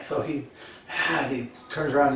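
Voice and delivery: ordinary delivery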